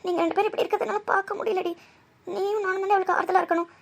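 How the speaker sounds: ordinary talk, nothing marked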